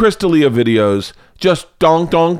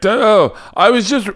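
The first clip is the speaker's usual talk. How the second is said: much higher, flustered squeaky reporter; a panicked guy scrambling to take it back; backtracking interviewer; 10 semitones higher, slower than usual. Retold or imitated. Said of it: "you could hear his backtracking".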